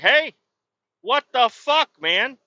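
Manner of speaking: sharp high shout of outrage; angry yell. Retold or imitated. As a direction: yell it at the guy who tricked you. Confronting excavator guy about boots.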